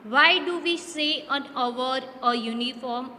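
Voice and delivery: slow reading. Shown as a demonstration. Teacher reads English question slowly.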